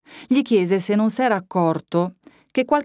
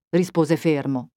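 plain reading voice

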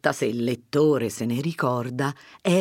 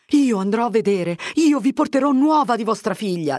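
firm declaration, higher and louder; resolute; 8 semitones higher, 5 dB louder than usual. A narrator's reading. Fermo promises Agnese news of her daughter, resolute. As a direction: state it with rising determination. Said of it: "disse Fermo risolutamente".

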